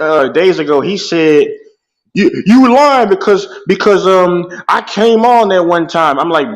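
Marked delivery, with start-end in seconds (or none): 2.1-6.2 mock accuser. Mocking rival's accusation.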